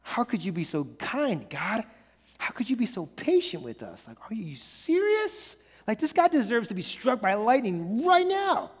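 hushed, stunned disbelief at God's patience; can't believe how patient God is, said softly and amazed; incredulous disbelief. Retold or imitated. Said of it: "And we're just like".

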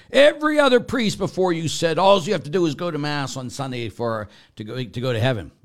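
high, loud, indignant imitation of a caller; yelling caller. Priest imitating the woman caller yelling at him. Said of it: "she's yelling".

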